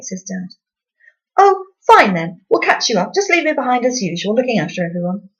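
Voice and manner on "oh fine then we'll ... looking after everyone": resentful Mum. A narrator's reading. Mum's resentful retort.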